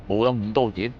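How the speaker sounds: ordinary delivery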